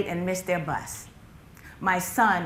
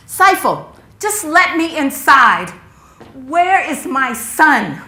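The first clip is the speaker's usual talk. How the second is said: loud, high, drawn-out shouting; frantic mother demanding her son; desperate demand; 7 semitones higher, 15 dB louder, slower than usual. Acted. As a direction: shout slowly to get past your brother. Demands brother let her in.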